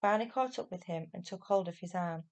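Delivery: ordinary delivery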